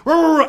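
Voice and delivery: loud, high rolling dog noise; frantic mock growling; imitated fighting snarl.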